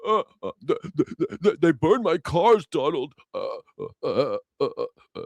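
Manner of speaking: hammed-up blubbering over burned cars, stuttering uh uh; mock crying Elon Musk. Mocking Elon Musk whining on TV about his burned cars, fake crying. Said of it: "on T V crying".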